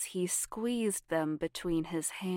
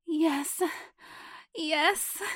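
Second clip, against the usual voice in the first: moaning; 10 semitones higher, 3 dB louder than usual. Acted. Moaning near climax.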